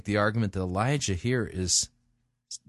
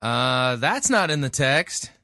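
loud, higher-pitched interjection; raised-voice disbelief; incredulous correction; 4 semitones higher, 5 dB louder, slower than usual. Real, not acted. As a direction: cut in with a baffled objection. Interrupts clip to object.

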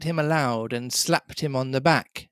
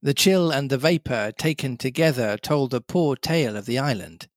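plain reading voice

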